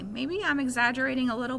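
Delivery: ordinary delivery, nothing marked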